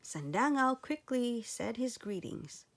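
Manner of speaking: plain reading voice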